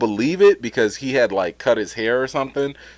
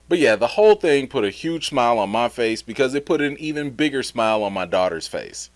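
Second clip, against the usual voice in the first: ordinary delivery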